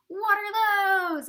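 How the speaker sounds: high, slow shouted mock yell. Bandmate heckling the drummer mid-solo, loud slow yell. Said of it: "He was like".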